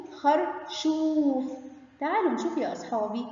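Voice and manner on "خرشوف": "خرشوف" very slow, oo stretched out; long oo. Shown as a demonstration. Teacher naming the vegetable for pupils, "خرشوف" with long oo. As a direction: draw out the "شو" inside "خرشوف". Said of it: "مد بالواو".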